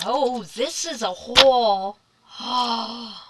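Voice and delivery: high, loud, thrilled discovery; excited gasp. Real, not acted. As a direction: gasp and gush with delight. Discovering a whole card front.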